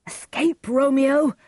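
high, urgent warning shout; crying out. A narrator's reading. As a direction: shout a friend into fleeing, high and loud. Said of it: "cried Benvolio".

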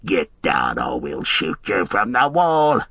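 low growled orc threat; lightly voiced orc warning. A narrator's reading. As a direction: threaten him like a brute orc. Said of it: "they cried".

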